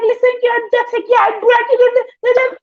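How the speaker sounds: sweet cussing out, mimicked very high; Sosa. Contrasting her nice-sounding telling-off with own, imitated high. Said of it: "she will tell you about yourself".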